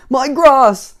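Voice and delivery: high, loud wail of dismay; distressed cry. A narrator's reading. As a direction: cry out over your ruined treasure. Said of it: "cried Mister Fussy".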